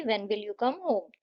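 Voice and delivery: when will you come home? dropping down at the end; slow wh-question that falls away on home; falling tune. Shown as a demonstration. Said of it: "आपकी tone जो है वो falling होती है".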